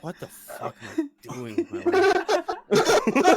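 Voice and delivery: man regretting his night, despairing wail. Retold or imitated. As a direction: cry out in mock despair. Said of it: "I'm like".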